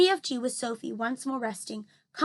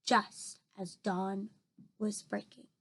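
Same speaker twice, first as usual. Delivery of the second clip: slow, lower, soft close; dramatic slow ending; 4 semitones lower, 9 dB quieter, slower than usual. A narrator's reading. Reader closes the chapter slowly and softly. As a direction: draw it out to end the chapter. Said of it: "slow hushed ending".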